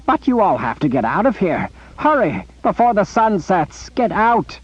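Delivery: urgent warning. Acted. Zed begging his rescuers to flee, urgent. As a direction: urge them out before sunset.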